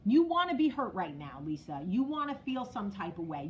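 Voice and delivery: usual speaking voice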